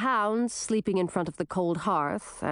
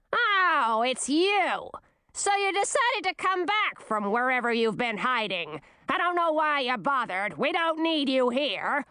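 high, sneering queen voice; scornful old queen; 5 semitones higher than usual. A narrator's reading. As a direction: sneer at your returning daughter.

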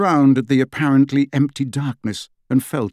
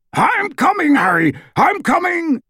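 high, loud urgent yell; Hagrid yelling; 3 dB louder than usual. A narrator's reading. Hagrid rushing to grab Harry, urgent loud yell. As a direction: shout it as you dive to the rescue.

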